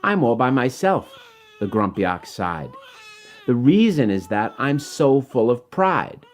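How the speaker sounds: measured rhyming storybook read over music; story narration. Acted. Author reading his rhyming book to children, gentle storytelling. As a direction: read it like a bedtime picture book.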